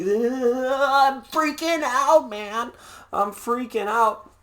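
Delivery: slow, high, hammed-up panicky voice; a hammed-up scared kid whimpering slowly; mock freaked out. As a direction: play a spooked trick-or-treater, drawn out. Scared kid meeting Halloween witches.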